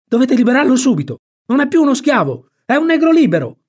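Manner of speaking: high, loud protesting yell; boy shrieking. A narrator's reading. Tom demands Jim be freed, shrieking in protest. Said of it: "Tom allora cominciò a strillare".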